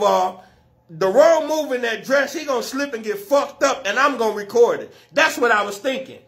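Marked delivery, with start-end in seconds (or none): none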